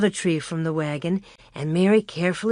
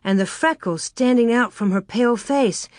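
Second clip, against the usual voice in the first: usual speaking voice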